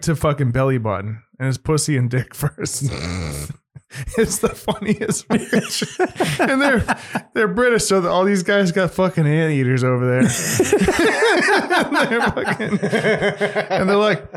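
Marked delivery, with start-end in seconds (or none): 4.2-6.8 laughing. Real, not acted. Laughing at the show. 10.2-14.3 laughing. Real, not acted. Laughing mid-story.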